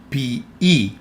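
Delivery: "P E" sounded out as pee-EE; pronunciation demo. Shown as a demonstration. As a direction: show "P E" as two syllables, both with an ee sound. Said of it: "So this word is pronounced".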